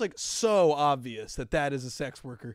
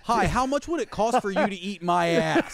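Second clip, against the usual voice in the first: louder, raised-pitch pretend pickup; mock proposition; 4 semitones higher, 6 dB louder than usual. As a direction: announce it brightly as a joke customer. Acting out a proposition to stranger.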